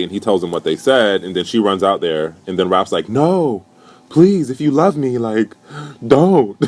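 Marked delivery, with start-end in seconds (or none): 3.1-6.6 high-pitched imitation begging; mock pleading. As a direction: beg in a put-on higher voice. Mimicking Raph begging Chanel.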